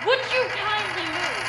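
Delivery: furious high-pitched scream; explosive, slow, high scream. Acted. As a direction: scream it at full volume, drawing out each word. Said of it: "and screamed".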